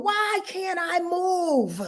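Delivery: high, slow, strained inner cry; pleading inner voice, pitched high and drawn out; internal scream. Acted. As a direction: let the trapped voice inside plead upward. Same inner scream repeated.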